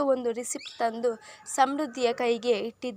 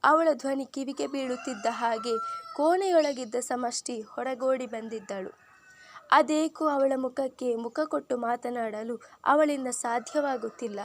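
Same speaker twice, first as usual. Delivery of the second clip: plain reading voice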